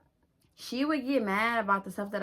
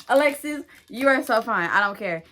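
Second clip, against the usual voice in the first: louder, higher shout-out to her crush; calling out; 4 semitones higher, 8 dB louder than usual. Real, not acted. Girl shouting out her old coworker crush, playful. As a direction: call his name out boldly.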